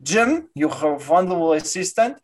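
put-on courteous Russian-accented doctor; thick Eastern European accent. Doctor complimenting people before the end, Russian accent. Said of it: "very Russian man".

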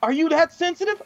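incredulous outrage. Retold or imitated. Instructor berating faculty director on phone, retold outrage. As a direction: re-enact scolding an oversensitive older man.

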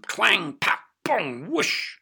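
twang, pat, bong, ending on a high whoosh; cartoonish collision noises; acted sound effects. A narrator's reading. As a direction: act out each noise separately. Sound effects of bird hitting net.

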